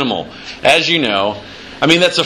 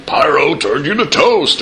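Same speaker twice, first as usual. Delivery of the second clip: punchy arcade announcer voice; game character voice; 5 semitones higher than usual. Shown as a demonstration. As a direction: bark it like an old arcade line. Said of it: "here's all my guys".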